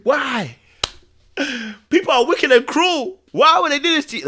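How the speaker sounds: high, pleading, half-broken cries; re-enacting his own sobbing at the film, high and pleading; anguished plea to Jesus. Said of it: "I'm like".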